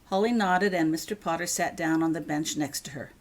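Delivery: usual speaking voice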